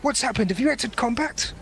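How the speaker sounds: concerned Jedi checking in; Jedi over holo-call. Acted. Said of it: "you hear of your hollow call the Jedi going".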